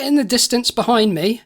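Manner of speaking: ordinary talk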